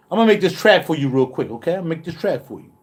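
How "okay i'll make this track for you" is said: ordinary delivery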